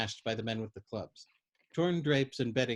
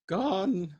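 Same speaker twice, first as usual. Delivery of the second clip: put-on high, loud mourning old woman; old woman mourns her husband, high and grieving; mournful; 8 semitones higher, 4 dB louder than usual. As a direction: mourn it like a lament. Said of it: "mourned the old woman".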